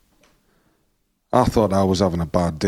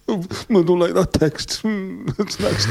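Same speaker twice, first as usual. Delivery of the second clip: higher, slowed mocking whine with a laugh; mock whiny prince; 9 semitones higher than usual. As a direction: parody a spoiled whinger. Mocking Harry whining.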